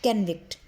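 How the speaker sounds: "कन्विक्ट" with a short, reduced "कन", pitch falling; correct pronunciation. Shown as a demonstration. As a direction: say "कन्विक्ट" with a schwa first, not an o. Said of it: "हम इसको पढ़ते कैसे हैं".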